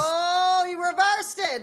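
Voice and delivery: high, loud surprised call. Real, not acted. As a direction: caught off guard by the reversal. Surprise reversal.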